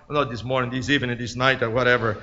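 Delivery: low, soft self-correction; offhand aside. Real, not acted. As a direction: drop voice and pitch for an aside.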